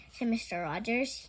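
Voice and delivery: ordinary delivery, nothing marked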